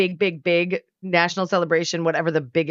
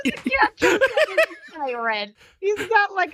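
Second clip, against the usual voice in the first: laughing; 13 semitones higher than usual. Real, not acted. Laughing describing cat pirate.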